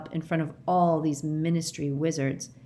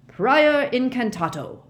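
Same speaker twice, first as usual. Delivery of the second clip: loud, slow commanding roar; 8 semitones higher, 7 dB louder, slower than usual. A narrator's reading. Mister Diggory casting a spell on Harry's wand, roaring. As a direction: bellow the spell. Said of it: "roared Mister Diggory".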